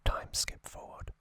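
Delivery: ordinary talk, nothing marked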